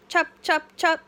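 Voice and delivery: high, loud chop chop; high playful chopping chant; baby chopping sound. Acted. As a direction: chant it like a toddler. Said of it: "says Honey".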